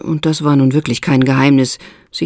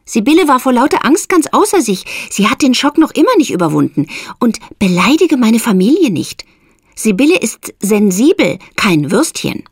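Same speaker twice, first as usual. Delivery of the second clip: Esther, protective and indignant; 8 semitones higher than usual. A narrator's reading. Esther defends her sister.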